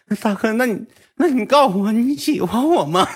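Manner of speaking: very high, needy mock whine; imitated tearful plea. Tearful junior asking if big brother likes them, mock pleading. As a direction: squeal it like a teary junior. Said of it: "他就哭了".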